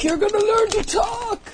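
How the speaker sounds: high, weak gangster voice; a badly done tough guy. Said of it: "not a very good gangster voice".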